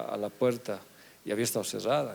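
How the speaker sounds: ordinary talk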